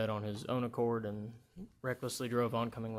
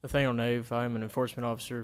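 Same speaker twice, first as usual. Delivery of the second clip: louder, projecting the name; speaking up; 6 dB louder than usual. Real, not acted. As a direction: speak up so the room hears. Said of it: "Speak up, please".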